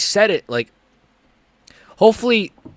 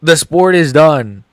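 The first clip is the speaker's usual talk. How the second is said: loud despairing verdict; 8 dB louder, slower than usual. Real, not acted.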